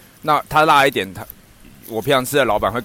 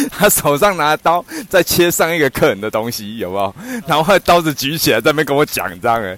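usual speaking voice

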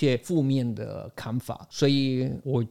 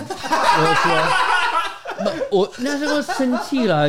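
laughing loudly while talking; words break into high laughter; 6 dB louder, slower than usual. Real, not acted. Laughing while retelling argument.

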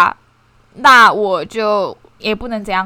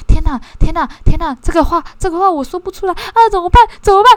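flustered panic; 7 semitones higher than usual. Retold or imitated. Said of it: "那妈妈就一直murmur".

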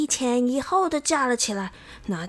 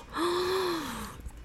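high, soft horrified gasp; acted sharp intake of shock; 7 semitones higher, 8 dB quieter than usual. A narrator's reading. Xiao Wang looks in the mirror, shocked gasp.

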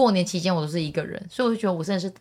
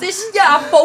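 much higher, loud scared cry; panicked shriek; 13 semitones higher, 9 dB louder than usual. Real, not acted. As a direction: squeal in sudden fright. Recalling boss caught her. (吓得尖叫)

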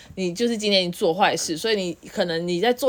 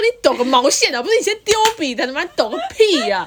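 louder, higher, laughing through a mock scold; exasperated retort; 8 semitones higher, 6 dB louder than usual. Teacher scolding a student who threw the pen, loud and laughing. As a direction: snap back at him, half laughing. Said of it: "然后我就很气".

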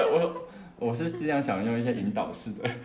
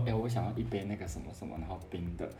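soft, murmured into a friend's ear; hushed aside at the counter; whispered order; 4 semitones lower, 10 dB quieter than usual. Retold or imitated.

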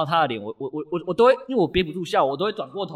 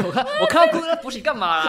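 laughing; 11 semitones higher, 5 dB louder than usual. Real, not acted. Defending himself while laughing.

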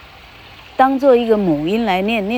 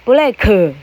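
Taiwanese-accented English; 2 semitones higher, 5 dB louder than usual. Shown as a demonstration. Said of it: "以台客来念是".

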